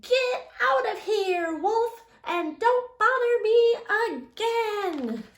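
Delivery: high, loud rude pig voice. Retold or imitated. Third pig yelling rudely at the wolf in a high pig voice. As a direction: snap back like a cheeky little pig. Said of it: "that rude little porker answered".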